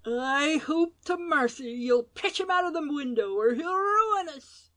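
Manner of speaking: high, cracked pleading crone; old servant begging master. A narrator's reading. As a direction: urge him on, fearful. Old woman urging master.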